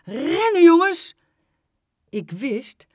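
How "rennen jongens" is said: loud, high alarmed command; high, full-voiced warning call; urgent shout. A narrator's reading. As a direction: yell to get the children moving. Teacher orders children to flee.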